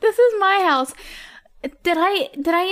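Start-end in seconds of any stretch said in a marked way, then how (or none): 0.0-0.9 raised voice. Real, not acted. Interrupting the quarrel.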